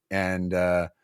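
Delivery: ordinary delivery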